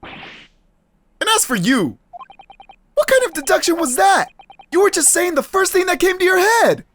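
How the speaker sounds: exasperated, high-pitched protest; indignant game hero. Acted. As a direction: tear into a bad deduction. Game hero scolds the detective.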